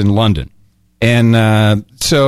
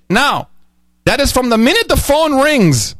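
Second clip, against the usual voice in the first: imitating an Indian call-centre agent, higher voice; mock Indian accent, pitched up; 11 semitones higher than usual. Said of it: "I'm sorry to do the accent".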